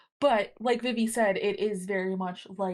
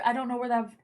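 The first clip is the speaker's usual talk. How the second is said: ordinary talk, nothing marked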